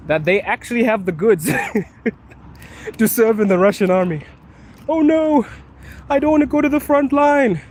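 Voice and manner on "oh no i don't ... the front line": much higher, louder mocking whine; mocking people afraid of being sent to the front; mock whiny recruit. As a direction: whine like a scared wannabe soldier. Mocking people dodging the draft.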